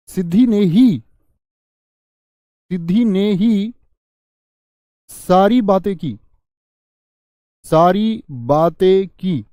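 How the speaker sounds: very slow, ही hit loud and drawn out; stress on ही. Shown as a demonstration. Teacher showing students how ही adds emphasis. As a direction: spell it out slowly, hammering ही. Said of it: "तो जोर दे दिया वाक्य में".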